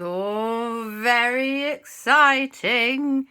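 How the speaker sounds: long drawn-out, rising words; building excitement. A narrator's reading. Storyteller on the queue for the roller coaster, drawn-out excitement. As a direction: stretch it out with swelling excitement.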